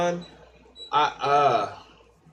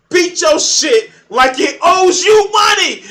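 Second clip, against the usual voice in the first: much higher, slow, very loud yell; shouted; 13 semitones higher, 12 dB louder than usual. Acted. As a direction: belt out the punchline. Crude joke, yelled punchline.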